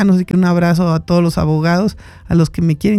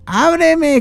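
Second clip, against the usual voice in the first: pleading open up; 9 semitones higher than usual. Retold or imitated. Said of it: "y luego le decía".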